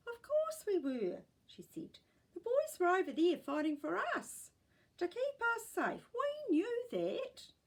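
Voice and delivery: put-on high, loud old lady, slowing at the end; great gran. A narrator's reading. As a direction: firmly reassure as great gran. Great gran answers in surprise.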